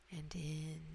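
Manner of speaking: ordinary delivery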